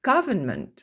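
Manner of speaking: Government with the stress still on GOV. Shown as a demonstration. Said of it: "et ça n'a pas changé". (accent tonique toujours sur GOV dans Government)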